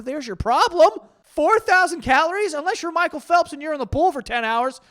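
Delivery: loud, high-pitched disbelief; incredulous outburst. Real, not acted. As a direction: exclaim it, voice jumping way up in exasperated shock. Incredulous outburst at calorie count.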